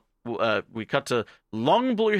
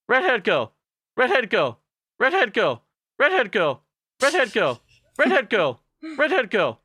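high, loud chant; repeated calling; 10 semitones higher, 4 dB louder than usual. Retold or imitated. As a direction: call her over and over. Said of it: "long blue-haired girl's like".

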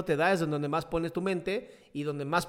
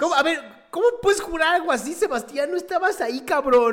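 much higher, louder disbelieving cry; incredulous outburst; 11 semitones higher, 9 dB louder than usual. Real, not acted. Friend challenging Sebastián's oath, incredulous and loud. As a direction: call out the story as impossible.

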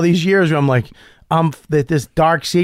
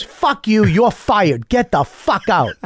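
higher, emphatic retold outburst; mock angry firing; 7 semitones higher, slower than usual. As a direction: play a boss sacking someone on the spot. Acts out boss firing new employee.

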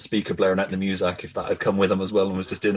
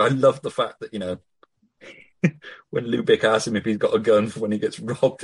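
usual speaking voice

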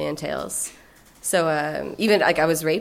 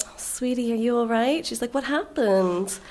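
friend's sweet voice; 5 semitones higher than usual. Retold or imitated. Imitating her best friend comforting her after the night, lightly mock sweet. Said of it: "And that's how she talks".

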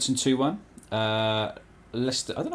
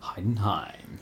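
breathy whisper of Heidenheim; whispered name; 3 semitones lower, 5 dB quieter, slower than usual. Pretend. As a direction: breathe Heidenheim almost silently.

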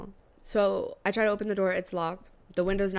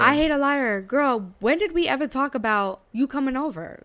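higher, louder indignant comeback; retold indignation; 6 semitones higher, 4 dB louder than usual. Retelling her answer to his false excuse, indignant. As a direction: call out the lie, pitched up and loud.

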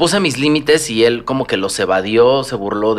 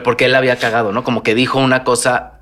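ordinary talk

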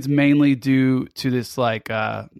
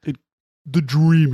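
forcing a deep voice. Acted. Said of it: "you sound like Kermit trying to put on a deep voice".

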